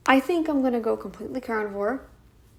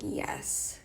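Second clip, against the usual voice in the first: whispered yes; 11 dB quieter than usual. Pretend. Body answers craving.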